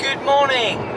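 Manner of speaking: high, slow drawn-out good morning; sing-song greeting. Acted. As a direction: stretch it out playfully.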